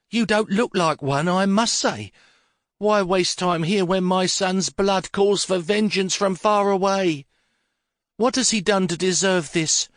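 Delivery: grieving matriarch demanding vengeance, high and sharp; furious old mother. Acted. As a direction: scold the policeman like an outraged old lady. Furious mother confronts the inspector.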